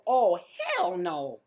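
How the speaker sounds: mock dad shutting it down hard; firm refusal. Dad refusing his kid, loud slow mock refusal. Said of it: "You go".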